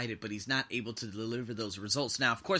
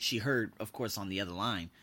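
ordinary delivery

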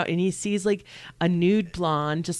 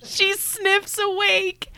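much higher, slow sniffing wake-up; high excited voice; slower than usual. Pretend. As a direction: squeak it out as she wakes. Acting out waking up.

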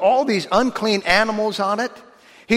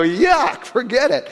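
higher, louder playful "Yuck"; mock disgust; 5 semitones higher, 3 dB louder, slower than usual. Preacher refusing a bowl of cucumbers, mock disgust. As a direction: wrinkle your nose at the cucumbers. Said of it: "I go".

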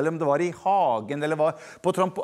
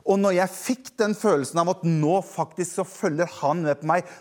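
ordinary talk

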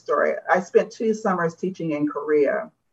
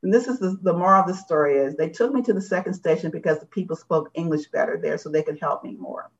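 ordinary delivery

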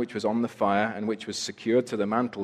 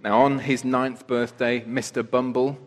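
ordinary delivery

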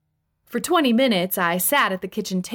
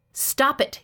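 mildly irritated, higher-pitched snap; short burst of annoyance; snapped; 6 semitones higher than usual. A narrator's reading. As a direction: cut them off testily. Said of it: "he snapped".

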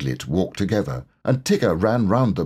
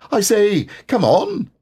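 higher, louder bouncy call; Tigger calling; 12 semitones higher, 4 dB louder than usual. A narrator's reading. Tigger calling to friends in the mist, high and loud. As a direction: shout cheerfully into the mist.

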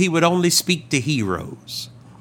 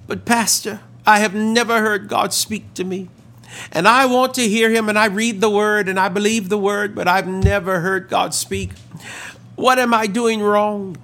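higher-pitched mild mock distress; imitated tearful plea; 6 semitones higher than usual. Woman confiding in her pastor after the meeting, imitated tearful plea. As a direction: plead like an upset woman, lightly acted. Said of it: "with great tears flowing down her face".

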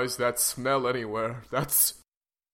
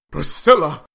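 loud accusing shout; angry shout; 9 dB louder than usual. Acted. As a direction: call her out angrily. Husband confronts wife.

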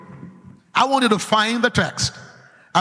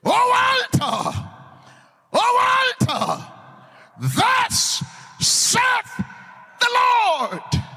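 crying out; much slower than usual. Acted. Man of God crying out against the altar, proclaiming. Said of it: "he cried against the altar".

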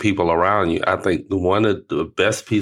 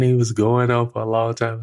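ordinary talk

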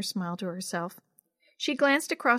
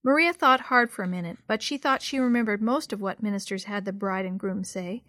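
ordinary delivery

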